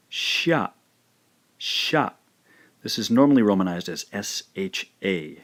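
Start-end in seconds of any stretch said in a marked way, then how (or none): 0.1-2.1 "sha" as one syllable, set high; one beat for "sha", no i; blended sha. Shown as a demonstration. Teacher showing learners the blended syllable, higher. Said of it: "we end up with".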